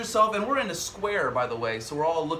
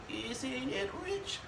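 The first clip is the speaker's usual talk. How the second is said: slow, soft, breathy crooning; [name] impression; 7 semitones higher, 10 dB quieter, much slower than usual. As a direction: croon it slowly like a Broadway diva. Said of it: "There's impersonation".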